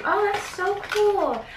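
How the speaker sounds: high, bright burst of delight; excited. Real, not acted. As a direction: squeal it, thrilled by the gift. Delighted at brush shampoo.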